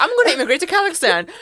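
high, loud and fast make-believe declaration; mock announcement. Imagined migrant announcing a wild move, mock excited. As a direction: blurt out a wild plan, pitched high.

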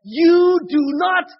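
fervent, loud sermon delivery; preaching. Acted. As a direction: preach it out with full force. Preaching to the audience.

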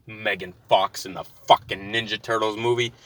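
ordinary talk, nothing marked

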